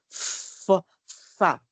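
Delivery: fo and fa split, F drawn out; word dictation. Shown as a demonstration. Teacher dictating a word, slow fo, fa syllables. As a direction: sound out fo, pause, then fa. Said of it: "ser o ditado de palavras".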